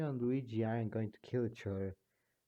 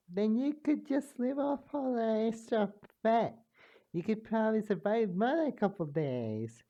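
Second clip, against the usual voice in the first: very high, teasing lilt; high mocking character voice; 9 semitones higher than usual. One character mocking another about extra fat, very high. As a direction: taunt him in a squeaky voice.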